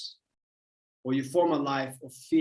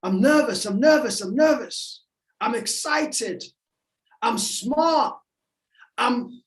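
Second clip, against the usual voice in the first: high, louder self-talk, each statement punched; insistent inner voice; 8 semitones higher, 3 dB louder than usual. Acted. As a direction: act out the voice in someone's head. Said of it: "That's the voice in your head saying".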